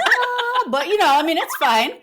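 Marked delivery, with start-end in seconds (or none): 0.0-0.6 high sung "Ah"; twinkly magic sparkle. Retold or imitated. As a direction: sing a bright high chime. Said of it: "It's called".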